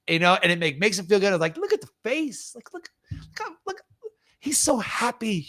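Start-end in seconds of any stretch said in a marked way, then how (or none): 1.6-5.4 high, softening coo of delight; re-enacted fond excitement; delighted gushing. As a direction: marvel in a high small voice. Gushing over volunteer's delight.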